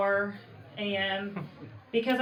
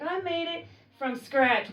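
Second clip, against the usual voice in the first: proud aunt, higher; 7 semitones higher than usual. Retold or imitated. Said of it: "I'd be like".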